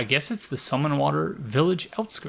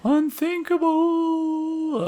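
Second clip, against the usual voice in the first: high, slow, eerie ghost voice; drawn-out spooky ghost wail; 13 semitones higher, much slower than usual. Acted. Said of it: "ghost voice to be too annoying".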